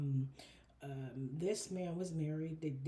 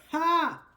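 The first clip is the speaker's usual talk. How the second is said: a sudden, much louder single laugh, pitched high; loud bark of a laugh; 10 semitones higher, 15 dB louder than usual. Real, not acted. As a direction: let one sharp "Ha!" burst out. Bursts out at the card.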